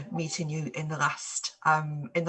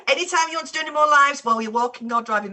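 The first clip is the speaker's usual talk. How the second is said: laughing; 8 semitones higher, 9 dB louder, faster than usual. Real, not acted. Laughing at the walk-and-drive joke.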